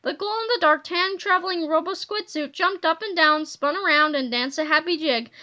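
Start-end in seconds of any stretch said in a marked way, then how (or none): none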